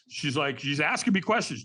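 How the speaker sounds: ordinary talk, nothing marked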